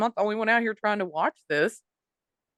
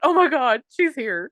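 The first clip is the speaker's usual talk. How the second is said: higher, urgent mock alarm; excited recognition; 6 semitones higher, 4 dB louder than usual. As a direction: gasp the news to your companion, playfully. Acted thrilled recognition of the woman.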